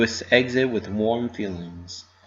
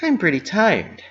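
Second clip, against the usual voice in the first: sleepy Pinky; 9 semitones higher than usual. A narrator's reading. Pinky tired.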